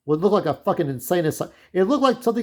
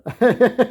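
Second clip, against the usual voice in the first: burst of laughter; 7 semitones higher, 5 dB louder than usual. Real, not acted.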